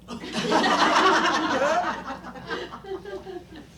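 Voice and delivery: high burst of real laughter. Real, not acted. Teller laughs at own punchline.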